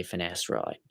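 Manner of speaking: "finasteride" in a put-on Australian accent; Aussie-accented "finasteride"; Australian pronunciation. Shown as a demonstration. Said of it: "That's how an Australian would say".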